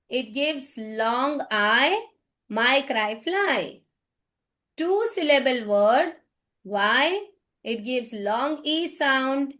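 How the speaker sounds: slow, clear classroom explanation; slow teaching voice. Shown as a demonstration. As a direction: spell the rule out slowly for kids. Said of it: "How we can teach this to the kids". (धीमी, साफ़ शिक्षक की आवाज़)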